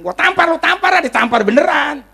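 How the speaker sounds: high-pitched, heated mock outburst; acted angry threat. Man threatening to slap someone, high and heated. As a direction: play a hothead spoiling for a fight.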